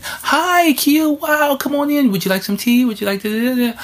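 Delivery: very high, overly polite welcome voice; same gushing host. Retold or imitated. Staff greeting the long-time teacher, still high guest treatment. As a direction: greet him high and sweet as if he's still new. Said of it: "The one hundredth time I come in".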